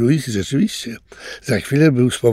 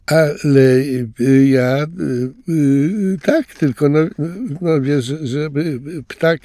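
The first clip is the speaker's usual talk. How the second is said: embarrassed stammer; 5 semitones higher than usual. A narrator's reading. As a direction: stumble over words, caught out. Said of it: "speszył się Louis". (speszone jąkanie, coraz ciszej)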